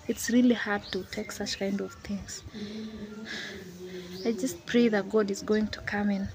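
ordinary talk

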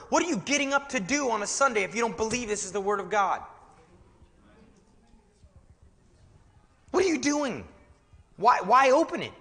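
high-pitched, incredulous challenge from the pulpit; indignant preaching. Real, not acted. Preacher challenging pastors who don't believe the word, indignant preaching. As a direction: fire the questions out, high and forceful.

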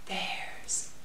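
secret whisper. Acted. Whispering to the children about the little mice. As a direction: breathe it like a secret.

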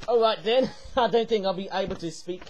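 normal talking voice

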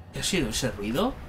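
low, soft wondering; wary question. Real, not acted. Player alone hears an odd noise, wary and hushed. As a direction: quietly check what that noise was.

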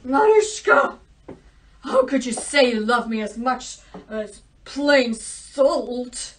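loud, indignant royal scolding; angry king. Acted. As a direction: banish her in a huff. King angrily banishes his daughter.

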